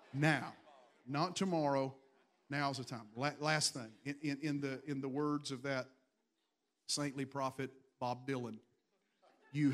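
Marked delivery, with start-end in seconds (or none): none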